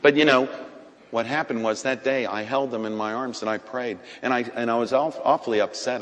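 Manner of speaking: ordinary talk, nothing marked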